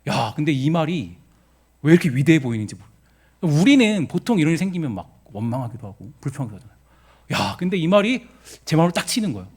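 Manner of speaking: usual speaking voice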